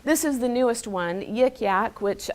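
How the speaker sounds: usual speaking voice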